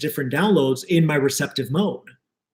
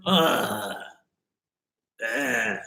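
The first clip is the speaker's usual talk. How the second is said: groan of disgust; 8 dB quieter than usual. Real, not acted. Disgust at saying sir.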